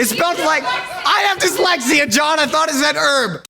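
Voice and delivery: high, loud indignant outburst with laughter creeping in; mock-outraged protest. Real, not acted. Actor teased by John over spelling, mock-outraged protest. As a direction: squeal in playful outrage.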